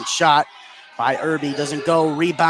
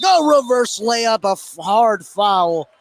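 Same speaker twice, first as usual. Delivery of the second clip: excited call; 4 semitones higher, 6 dB louder, slower than usual. Real, not acted. Live call of a driving layup.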